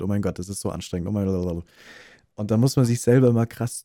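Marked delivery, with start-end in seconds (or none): 0.0-1.6 fast, hurried, breathless rattling; rushed reading. Shown as a demonstration. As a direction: rush through it like a nervous presentation. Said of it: "der die ganze Zeit so redet".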